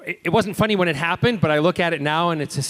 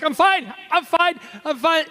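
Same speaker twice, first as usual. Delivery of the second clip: much higher, strained insistence through pain; strained I'm fine; 12 semitones higher than usual. Retold or imitated. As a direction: insist you're fine while clearly hurting. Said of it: "he's like".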